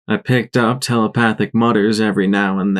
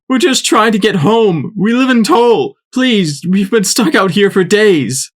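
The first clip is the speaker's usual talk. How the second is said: higher, louder desperate plea; pleading buck; 11 semitones higher, 4 dB louder than usual. A narrator's reading. Lead buck pleading with the rangers for his family. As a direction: beg to be let go home.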